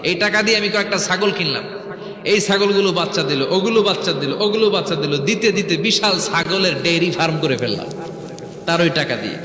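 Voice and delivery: normal talking voice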